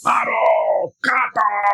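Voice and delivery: high, drawn-out battle shout; war cry. Acted. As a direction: yell it like a charging warrior. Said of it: "तो ऐसे जोर से चिल्लाते हैं". (युद्ध का ज़ोरदार नारा)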